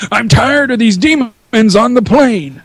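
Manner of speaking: high, drawn-out movie-star complaint; slow, put-on fed-up action hero; Samuel Jackson impression. Co-host casting a remake, mock fed-up movie star. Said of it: "Mutter Truck and Samuel Jackson".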